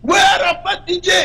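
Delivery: imitated street call from excited policemen, pitched high; policiers qui crient. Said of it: "C'est les policiers qui crient dans la rue". (imitation de policiers qui interpellent dans la rue)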